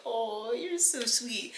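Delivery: sweetly gushing, lightly imitated; mushy gushy Oliver. Oliver touched by Charles's bachelor party offer, mildly mushy. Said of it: "mushy gushy".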